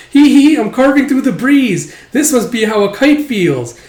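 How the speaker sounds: put-on high, loud gleeful kid voice; giggly little-kid glee; playful child. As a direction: play a giddy kid at play. Acted child playing at being kite.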